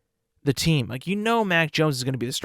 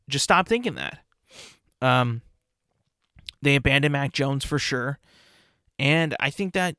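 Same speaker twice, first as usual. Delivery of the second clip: normal talking voice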